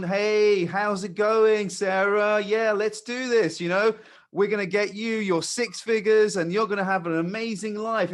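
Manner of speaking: high, loud hyped-up sales pitch; over-excited salesman. Acted. Mimicking the salesperson prospects expect, over-excited. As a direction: gush with pushy, hammed-up excitement. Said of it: "very excited".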